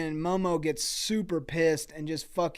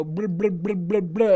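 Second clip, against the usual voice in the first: mocking blah blah; 3 semitones higher, 6 dB louder than usual. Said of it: "And Merdick go".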